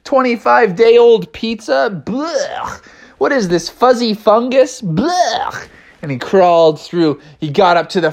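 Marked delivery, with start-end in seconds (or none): 0.0-5.7 disgusted, with retching blechs; Bubby. Acted. Bear lands in the smelly garbage truck, revolted voice. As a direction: recoil from each foul discovery. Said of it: "Bubby was like".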